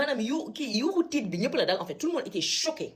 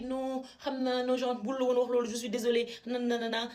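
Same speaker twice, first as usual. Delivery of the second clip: higher, hurried placating voice of the director; a higher, mock-apologetic imitation of the director; director apologizing; 5 semitones higher than usual. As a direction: mimic her soothing and backpedalling. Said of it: "Elle a dit".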